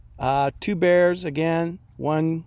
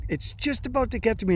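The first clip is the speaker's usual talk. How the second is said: ordinary delivery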